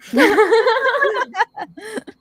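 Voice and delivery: big high-pitched laugh; loud high laugh. Real, not acted. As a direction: burst out laughing loudly.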